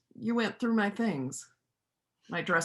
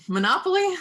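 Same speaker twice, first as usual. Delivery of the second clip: playful challenge; 11 semitones higher, 9 dB louder than usual. Acted. Playful challenge over games.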